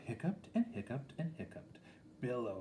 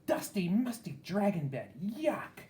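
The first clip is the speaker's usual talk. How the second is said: higher, louder disgusted whine; disgusted shriek; 9 semitones higher, 4 dB louder than usual. A narrator's reading. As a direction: shriek out the yuck. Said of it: "the princess shrieked".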